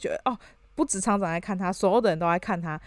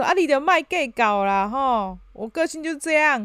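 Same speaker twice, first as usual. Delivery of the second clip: Taiwanese boss; 5 semitones higher than usual. Retold or imitated. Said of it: "他说".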